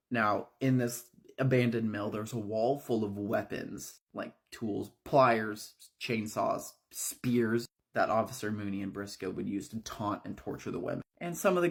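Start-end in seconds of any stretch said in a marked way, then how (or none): none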